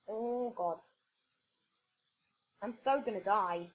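usual speaking voice

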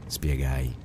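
plain reading voice

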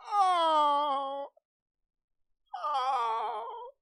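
high, wailing hammed-up sobs; fake crying. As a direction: fake cry it over the top. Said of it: "let me fake cry".

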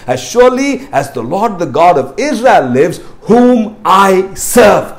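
declaiming scripture to the congregation; scripture reading. Acted. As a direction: proclaim the verse, slowing on whom I serve. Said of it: "Note what the Bible says".

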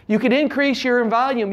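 ordinary talk

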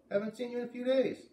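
put-on high, bright girl calling out; high-pitched girl calling across the yard; voiced farm girl calling. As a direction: call out as a friendly farm girl. Beat greets narrator.

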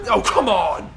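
loud outburst of frustration; booming cry of protest; exasperated shout. Real, not acted. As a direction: yell it out, fed up. Loses car in game.

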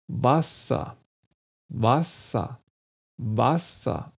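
WASSER with open A and weakened A-like ending; careful pronunciation demo. Shown as a demonstration. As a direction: say WASSER slowly, syllables apart, no R at the end. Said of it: "Hört mal zu".

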